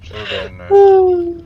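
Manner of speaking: slow, high, loud shout; being chased. Real, not acted. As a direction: drag it out in a yell.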